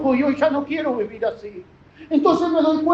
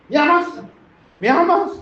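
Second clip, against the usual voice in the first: higher, louder insistent question; Jesus pressing; 5 semitones higher, 4 dB louder than usual. Acted. Jesus questions Peter over and over, louder insistent asking. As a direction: press him harder each time.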